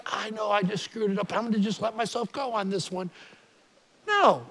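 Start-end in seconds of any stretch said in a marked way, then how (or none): 0.1-3.1 a low, hushed shrug of self-excuse; careless self-talk. Pretend. Preacher mocking a careless believer, lower and softer. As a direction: mutter it like you're letting yourself off the hook. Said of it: "You don't give grace like".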